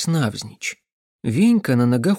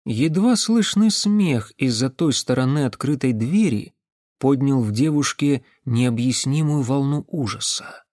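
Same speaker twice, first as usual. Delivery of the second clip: ordinary delivery, nothing marked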